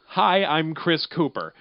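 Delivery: ordinary delivery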